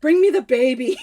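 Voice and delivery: loud, dramatic summons; mock-desperate demand. Panicking mother demands her child, loud melodrama. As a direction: call out as if on the deathbed.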